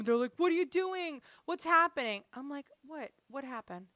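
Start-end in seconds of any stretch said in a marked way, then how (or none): 0.4-2.2 high-pitched imitation of protesting kids; mock alarm, pitched well up. Kids alarmed as mom takes things. 2.8-3.9 soft, feigned cluelessness; playing innocent. Mom playing innocent to kids.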